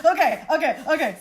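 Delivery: loud, high excited okays. Real, not acted. Reacting to the cute guy's compliment, loud and thrilled. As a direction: burst out thrilled.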